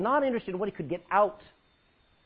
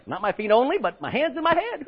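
high, loud, gushing with a laugh; eager Peter; 6 semitones higher, 5 dB louder than usual. Acted. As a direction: gush it, over the top. Peter's eager reply, acted.

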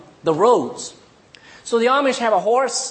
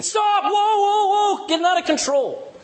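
panicked yelling; 13 semitones higher than usual. Retold or imitated. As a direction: lose control and yell at the horse.

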